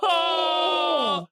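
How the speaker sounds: high, drawn-out howl of "oh"; shocked wail. Real, not acted. Streamer catching Joe actually crying, high shocked howl. Reacting to Joe's tear.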